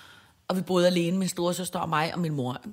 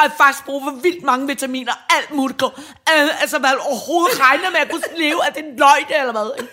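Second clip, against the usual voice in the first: louder, higher scolding of mom; indignant teen; 5 semitones higher, 8 dB louder than usual. Retold or imitated. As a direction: tell mom off like an outraged teenager. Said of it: "så siger hun til min mor".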